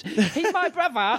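high, slow, laughing British gangster; laughing mock British accent. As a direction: ham it up like a London tough. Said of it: "That's, that's so British".

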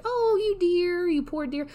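high, slow, drawn-out pitying coo; imitated Southern sympathy. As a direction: coo with mock pity. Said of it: "They mean hun like".

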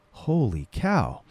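slow, soft mock amazement; awed inner thought. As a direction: voice his silent wonder, drawn out and hushed. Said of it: "on his face, he's just like".

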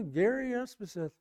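softly spoken name; understated introduction. Retold or imitated. Preacher giving his name to a truck driver, quietly. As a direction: give the name quietly, almost offhand. First answer, not caught by driver.

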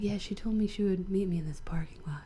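soft unvoiced whisper, secretive. Stranger at the door explaining the meetup, furtive whisper. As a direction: whisper it like a furtive hookup plan.

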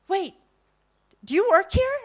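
halting, then high and loud; mortified realization. Retold or imitated. Woman realizing she misjudged her seatmate, mortified. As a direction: act out a dawning, horrified question. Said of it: "she's mortified".

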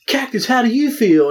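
high-pitched mock interviewer. Podcaster mimicking promo interviewers, mock high-pitched questioning. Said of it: "they're like, over there".

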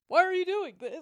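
high, loud, laughing wail; mock cracking voice. Imitating the boyfriend after he resets, loud high mock wail. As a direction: wail it like a squeaky teenager. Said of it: "he has that weird crack".

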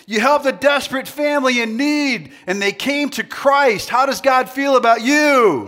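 high, loud build to the question; rousing shout. Real, not acted. Preacher asking crowd about helping a family, rousing shout. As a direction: rev them up, peaking on the question. Rallying crowd to cheer good news.

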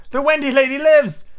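high, loud proclamation; excited cry. A narrator's reading. Slightly announcing that Wendy lives, crying out excitedly. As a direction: cry it out joyously at the top of the voice. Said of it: "Slightly cried instantly".

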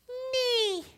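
tiny high-pitched squeak of a nee; thin high nose-whistle nee; high whistling nee. Retold or imitated. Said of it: "He goes".

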